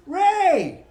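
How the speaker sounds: ordinary delivery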